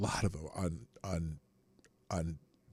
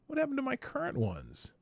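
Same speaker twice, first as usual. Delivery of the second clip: high, baffled complaint; puzzled and annoyed, pitched up; where did they go, said high; exasperated confusion. Real, not acted. Lost bookmarks.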